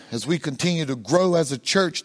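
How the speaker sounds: normal talking voice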